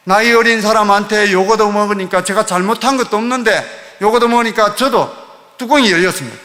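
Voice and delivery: ordinary delivery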